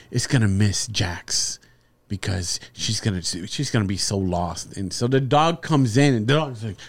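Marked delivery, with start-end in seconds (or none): 0.1-4.8 low, soft, sugary mockery of the family; mock sappy family voice. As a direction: lay on fake sentiment, quietly. Mocking family's sappy dog talk.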